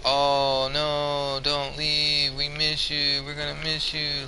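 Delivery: high, soft, sarcastic fake sorrow; mocking imitation of sad fans; mock pleading. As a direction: beg them to stay, without meaning it. Mocking imagined fans begging him to stay.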